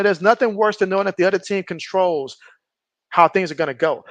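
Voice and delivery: normal talking voice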